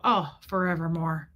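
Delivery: lightly imitated low voice; low, falling reaction to shocking news; Fred's catchphrase. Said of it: "He'd go".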